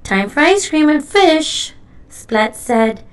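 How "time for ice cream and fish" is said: loud and high, delighted about food; eager announcement. A narrator's reading. Splat with rumbling tummy, eagerly announcing snack time. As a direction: call out the treat happily.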